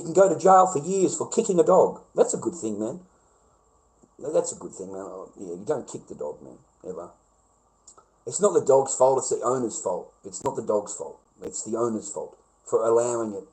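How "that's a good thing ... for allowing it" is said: quiet. Real, not acted. Dropping to a low hush.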